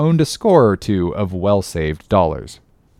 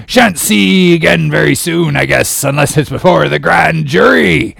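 high, loud crazed prophet rambling; cracked, frantic; 6 semitones higher, 6 dB louder than usual. Acted. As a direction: build from muttered warning to shouted farewell. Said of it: "with these cracked words".

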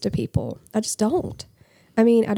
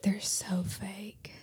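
hushed, drawn-out imitated whisper; gossipy whisper; 14 dB quieter, much slower than usual. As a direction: whisper it slowly like people judging others. Voicing people's gossip behind backs.

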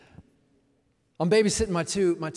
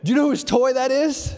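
much higher, louder teasing father; mock-stern lecture; 8 semitones higher, 10 dB louder than usual. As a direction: put the kid in their place, hammed up. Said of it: "I said".

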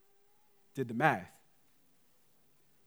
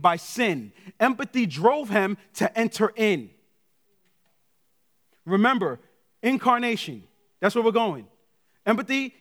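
raised, louder preacher's delivery; impassioned preaching; 5 semitones higher, 5 dB louder than usual. Real, not acted. Preacher tells congregation how God entered in, impassioned. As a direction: drive the point home from the pulpit.

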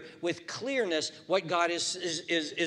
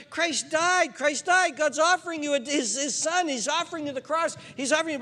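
urgent pleading; 11 semitones higher, 5 dB louder than usual. Acted. Pleading with unbeliever.

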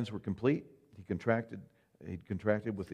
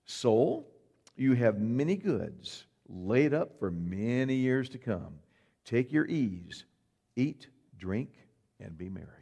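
slow, smug pat on the back; self-congratulating; slower than usual. Acted. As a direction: congratulate yourself, drawn out and pleased. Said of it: "puts himself in the chair to congratulate himself".

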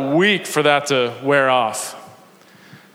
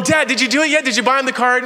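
put-on eager kids; 7 semitones higher, faster than usual.

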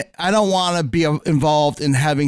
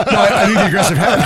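stifled giggles; 5 semitones higher, 5 dB louder, faster than usual. Real, not acted. Cracking up at the joke.

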